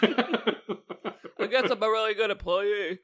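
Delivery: slow, congested head-cold voice; mock stuffy-nosed drawl; stuffed-up nose. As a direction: talk through a badly blocked nose, imitating a sick man. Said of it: "'Cause he's super stuffy".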